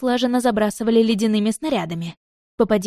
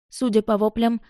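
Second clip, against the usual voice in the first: usual speaking voice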